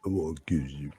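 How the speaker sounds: low, soft Rocky voice; Rocky impression. Voicing Rocky in the clip.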